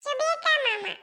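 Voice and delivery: put-on high-pitched little child voice; small child. Child telling uncle who he is, small child voice. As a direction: answer like a tiny, lisping child.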